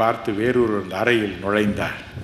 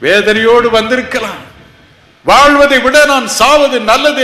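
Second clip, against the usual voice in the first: loud, high impassioned cry; fiery sermon cry; preaching at full force; 12 semitones higher, 13 dB louder than usual. Acted. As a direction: voice the listeners' despair at full force. Preaching the listener's despair.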